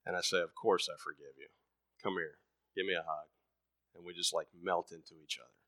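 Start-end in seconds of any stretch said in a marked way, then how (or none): 0.4-3.3 low, soft forgiving dad; tender father. Retold or imitated. Father forgiving his crying child, soft and tender. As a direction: gently reassure the little one.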